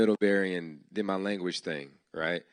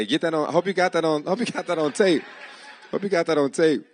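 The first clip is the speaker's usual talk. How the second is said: loud and higher, laughing through the words; 8 semitones higher, 6 dB louder than usual. Real, not acted. Host joking about getting his words on tape, laughing. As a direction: joke it out while laughing.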